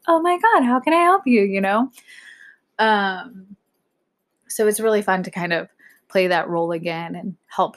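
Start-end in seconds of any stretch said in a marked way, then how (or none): 0.1-1.4 high-pitched, loud, bubbly service greeting; chirpy barista voice, pitched way up; customer service voice. Retold or imitated. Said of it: "where I get super high-pitched".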